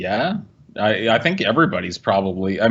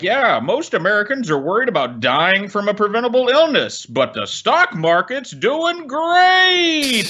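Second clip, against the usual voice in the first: mocking news voice; 9 semitones higher than usual. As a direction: sneer it like a cheery newscaster. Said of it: "how people are like".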